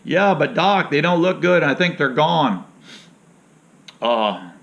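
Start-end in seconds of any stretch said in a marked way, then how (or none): 0.0-2.6 mock alarmed patient. Patient voice in imagined scene.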